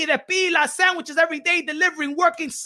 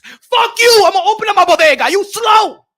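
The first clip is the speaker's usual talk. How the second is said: much higher and louder, fast and scornful; defiant shout; 6 semitones higher, 10 dB louder than usual. Retold or imitated. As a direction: bark it out in defiance. Defiant immigrant voiced in rant.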